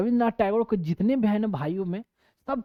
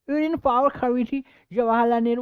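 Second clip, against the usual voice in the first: higher, louder, foreign-sounding English accent, imitated; Shashi Tharoor's foreign English accent; 6 semitones higher, 5 dB louder than usual. Teacher mimics Shashi Tharoor's foreign English accent for students. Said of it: "normal English नहीं हमारी तरह से बोलते हैं".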